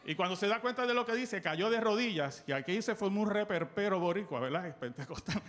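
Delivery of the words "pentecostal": usual speaking voice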